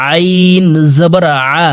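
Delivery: slow আইন squeezed from the middle of the throat. Shown as a demonstration. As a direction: demonstrate আ as an Arabic ayn, drawn out. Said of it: "হলকের মধ্যখান থেকে উচ্চারণ করব". (আইন হলকের মধ্যখান থেকে)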